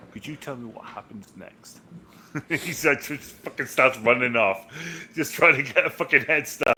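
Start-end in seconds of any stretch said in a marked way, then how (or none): 0.1-1.8 mock hushed question. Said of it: "It's like".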